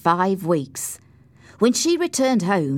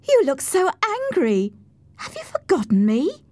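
put-on high, playful young lady's voice; teasing girl; 11 semitones higher than usual. A narrator's reading. Cathy teases her angry-looking friend, high girlish voice. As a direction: tease him in a much higher voice.

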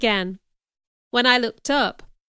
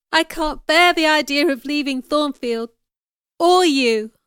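higher-pitched, distressed plea; understated sobbing; 5 semitones higher, 5 dB louder than usual. A narrator's reading. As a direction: plead as if unable to hold back tears. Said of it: "I sobbed".

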